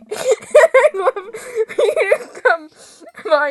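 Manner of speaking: very slow, high, laughing words; laughing invitation. Acted. Neighbor invites player to barbecue, laughing. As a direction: drag it out through giggles.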